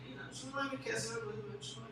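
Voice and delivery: normal talking voice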